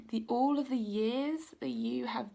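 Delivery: words catching as feeling rises; getting emotional. Real, not acted. Reader moved while telling viewers they are recognised, choked whisper. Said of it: "I'm getting emotional".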